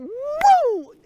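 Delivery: very high, drawn-out woo; retold whoop. Thief swerving past Roy's car, high imitated whoop. Acts whoop while passing Roy.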